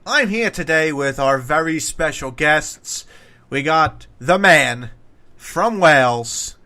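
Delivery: slow, drawn-out guest build-up; punched words with long suspenseful gaps; announcer introduction. Acted. Ring announcer style introduction of guest.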